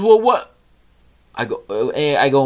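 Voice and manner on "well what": put-on much higher, louder child's wail; whiny upset son. Son insists to dad it's the worst day, higher whiny kid voice. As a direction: protest like a kid who's had the worst day. Son answers dad's question.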